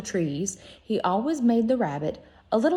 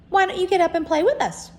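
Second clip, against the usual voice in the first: higher, louder, quick teasing rabbit; furry rabbit; 11 semitones higher, 6 dB louder, faster than usual. Acted. Wild rabbit inviting the toy rabbit to play, quick and high. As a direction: dare him brightly to come and play. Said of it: "one of them asked".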